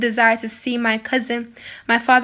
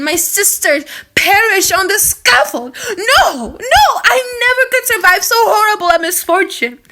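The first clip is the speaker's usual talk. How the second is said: tearful pleading; 5 semitones higher, 5 dB louder than usual. A narrator's reading. Elizabeth weeping with the condemned.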